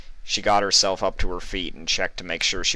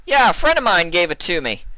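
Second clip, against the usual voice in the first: shouted over gunfire; 12 semitones higher, 8 dB louder than usual. A narrator's reading.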